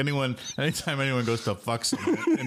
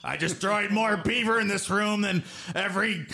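raised mock ghostly boast; old ghost boast; 7 semitones higher than usual. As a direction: brag like a ghostly old frat legend. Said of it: "You sound like Vince McMahon in the afterlife".

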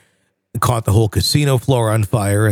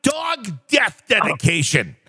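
a hint of the furious radio host, higher; lightly mock the angry DJ outtake; Casey Kasem rant; 9 semitones higher than usual. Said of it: "the Casey Kasem outtake reel".

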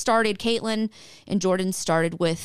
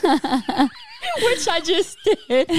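laughing; 9 semitones higher, much slower than usual. Real, not acted. Laughs at her own stutter.

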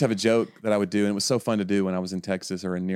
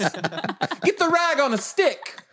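much higher, laughing, hammed-up whining; overfed grown kid whining to his mother to be cleaned; whiny spoiled child; slower than usual. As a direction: beg like a pampered overfed child. Spoiled kid bit, rolls.

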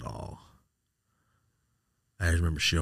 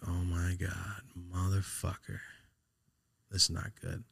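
hushed, sinking retold reaction; quiet dismay; 6 dB quieter than usual. Retold dread on hearing uncle's voice.

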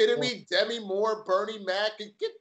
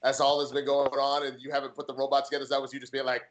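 usual speaking voice

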